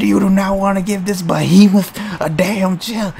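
ordinary delivery

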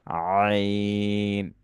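আইন drawn out very long, pressed in the throat; কণ্ঠে চাপ দিয়ে টানা আইন; throat-pressed ain. Shown as a demonstration. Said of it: "কণ্ঠের মধ্যে ... চাপ দিব".

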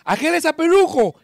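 high, loud thrilled call; excited shout. Acted. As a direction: spot him and shout with joy. Drunk spots Saint Peter.

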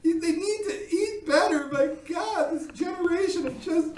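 overacted tearful rant about fat children; hammed-up blubbering. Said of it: "my crying and blubbering".

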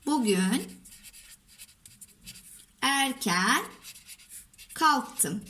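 word by word, long pauses between; dictating while writing. Shown as a demonstration. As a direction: dictate slowly as you write each word. Says words while writing them.

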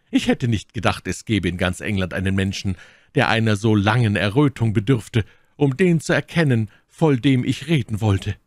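emphatic, rising indignation; indignant Milady. Acted. Milady marvels anyone needs telling whom she means, indignant. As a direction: build in pitch and force as the outrage grows.

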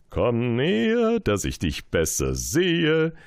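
a proud king beckoning his subject. Acted. King speaks to prince.

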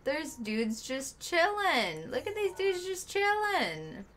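high, slow, delighted drawl; amused. Real, not acted. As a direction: marvel at lazy dudes. Spots NPCs lounging.

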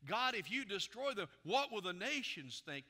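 acted urgent plea, projected to the room; impassioned Moses. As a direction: press the argument out at full force. Repeats Moses's appeal more forcefully.

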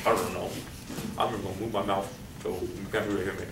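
low, quiet, mumbled student excuses; imitating a student muttering an answer so it can't be heard; mumbling student. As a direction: mumble like a student dodging the question. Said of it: "I've heard lots of".

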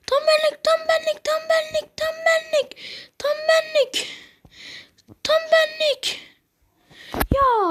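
very high, squealing with glee; excited squeal. Real, not acted. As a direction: shriek it out in delight. Heyecanla tiz sesle konuşuyor. (çok ince sesle sevinç çığlığı)

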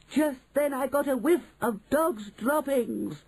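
high, slow witchy cry; alarmed witch. Acted. Witch tells others of the whiff, high alarm. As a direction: announce it high and stretched in alarm.

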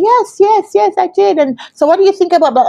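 high, fast, overeager yeses; bright, excited yes yes yes; eager. Retold or imitated. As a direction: jump at the chance. Eager self-quote on call.

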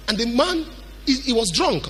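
ordinary talk

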